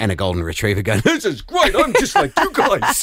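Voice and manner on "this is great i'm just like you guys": high, happy goofy dog voice; dog's voice. Acted. Host voicing the retriever among the kelpies, high and goofy. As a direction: voice the golden retriever, delighted to fit in.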